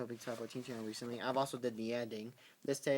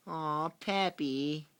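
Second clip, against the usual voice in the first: cooing at the pet; 5 semitones higher, 4 dB louder, much slower than usual. Real, not acted. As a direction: coo the pet's name slowly, fondly.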